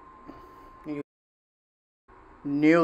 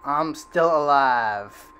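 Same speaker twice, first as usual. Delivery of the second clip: slow triumphant boast; 5 dB louder, much slower than usual. Real, not acted.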